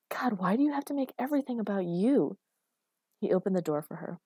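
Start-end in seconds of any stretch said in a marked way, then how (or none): 0.1-2.4 higher, pleading exasperation; fed-up reproach; exasperated. Acted. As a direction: vent frustration with a rising then falling swoop. Exasperated reproach in acted quarrel.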